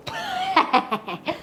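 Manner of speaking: high mocking scoff of a laugh; snooty laugh. Shown as a demonstration. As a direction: laugh off the idea of a wolf. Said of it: "act a little snooty".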